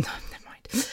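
breathy hushed take-back; whispered aside. Real, not acted. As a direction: drop to a whisper to retract it. Embarrassed aside, cutting herself off.

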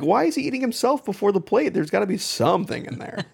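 high-pitched incredulous retold reaction; baffled disbelief. Retelling own baffled reaction to the scene.

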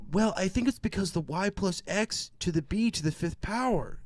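high, nasal-free nerd voice; nerdy student. Retold or imitated. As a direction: answer like an eager nerd kid. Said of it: "friend is just like".

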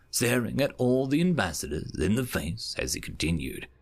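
ordinary delivery

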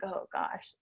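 breathy whisper, very soft; whispered dread. Retold or imitated. Dreading the midwife's check.

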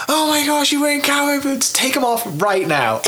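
high-pitched, scandalized outburst, hammed up; mock shocked friends. Friends spotting his cowboy boots at a reunion, mock shock.

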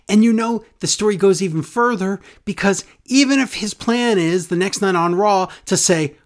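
normal talking voice

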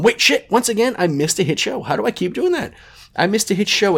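high-pitched self-reproach; exasperated at himself. Real, not acted. Host realizing he missed a hit show, exasperated. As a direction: catch your own slip, voice jumping up.

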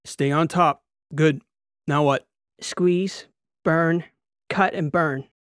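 punchy procedure calls; urgent drill commands. A narrator's reading. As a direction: call out each step with urgency.

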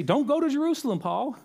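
high-pitched mock plea; pleading friends. Friends beg Paul not to go to Jerusalem, pleading. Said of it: "And they say".

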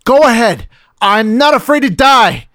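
high, loud defiant shout; shouting through gritted teeth. Acted. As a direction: defy them, yelling. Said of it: "Jim shouts through gritted teeth".

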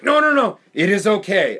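imitating friend. Quoting the friend at the party, high mock cheer. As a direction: brush off the warning cheerfully in a mock voice. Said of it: "He's like".

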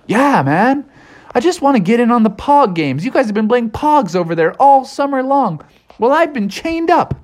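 high pleading character voice; eager, aggrieved beast. A narrator's reading. Beast wants in on pog games, high pleading voice.

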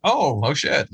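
mock shocked reaction; surprised. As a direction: act out a startled viewer. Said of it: "it was also surprising".

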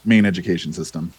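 ordinary talk, nothing marked

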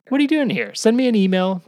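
excited call out. Real, not acted. Calling out to listeners.

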